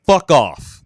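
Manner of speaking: sudden loud snap; loud brush-off. Retold or imitated. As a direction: bark it out, fed up. Acted brush-off at ATM.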